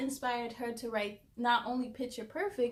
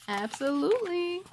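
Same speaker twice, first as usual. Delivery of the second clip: ordinary delivery, nothing marked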